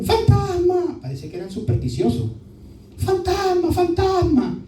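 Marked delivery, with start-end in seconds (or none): 0.0-1.0 high, startled cry of fright; frightened yell. Acted. Disciples seeing a figure at night, high scared shout. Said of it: "ellos gritaron". 3.0-4.6 high, startled cry of fright; frightened yell. Acted. Disciples seeing a figure at night, high scared shout. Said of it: "ellos gritaron".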